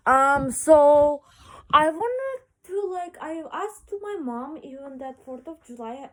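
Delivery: ordinary delivery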